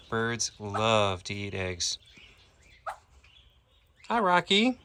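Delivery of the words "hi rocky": cooing pet voice. Real, not acted. Greeting Rocky the parrot in a high cooing pet voice. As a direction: coo a hello up high like to a pet.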